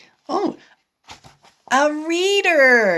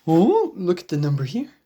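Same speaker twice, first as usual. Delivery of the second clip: ordinary delivery, nothing marked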